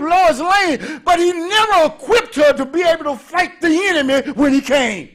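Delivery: loud, driving sermon delivery; full-force preaching. Acted. Preacher driving home his point, loud and forceful. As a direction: preach it hard to the congregation.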